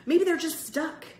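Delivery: high, loud, pressing suggestion; hopeful urging. A narrator's reading. Boy urging Greg not to give up, high loud encouragement. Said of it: "Bird urged Greg".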